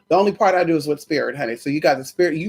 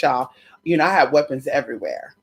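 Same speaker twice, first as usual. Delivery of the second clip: usual speaking voice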